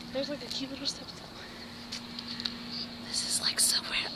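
whispered; hushed whisper. Real, not acted.

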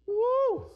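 rising pulpit whoop; high whoop. Real, not acted. Preacher reacting over church and fishing, high whoop. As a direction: let out a high "woo".

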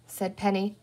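plain reading voice

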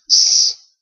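S as a voiceless hissed "sss"; S sound. Shown as a demonstration. Teacher explaining C before I or E, hissed S. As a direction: hold out S as a pure consonant. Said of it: "then it sounds like the S".

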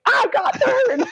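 high laughing delivery; giggling while saying it; laughing retort. Retold or imitated. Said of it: "I'm like".

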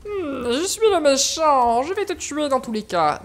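lightly voiced mock villain, slow start. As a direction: put on a hammy bad-guy voice. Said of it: "Pourquoi t'as une voix de méchant comme ça?".

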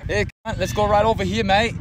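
high, loud mock Australian accent; Australian impression. Shown as a demonstration. Interviewee performs for the camera, brash put-on Australian accent. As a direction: call out like a rowdy Australian. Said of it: "Could you do an Australian impression".

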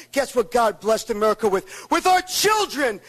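ordinary talk

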